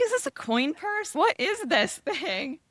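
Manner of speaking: high, baffled mock question, drawn out slowly; puzzled high-pitched disbelief at a coin purse. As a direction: squeak it out as if holding up a weird gift. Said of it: "I was like".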